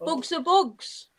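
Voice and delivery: slow, a touch of South London; bugs, drawn out in a light London voice; South London accent. Acted. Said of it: "I do like the way you say bugs".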